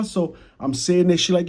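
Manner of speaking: normal talking voice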